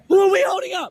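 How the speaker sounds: loud, angry shout. Real, not acted. Golfer yelling at the marshal who is kicking him off, angry shout. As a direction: yell it back in his face.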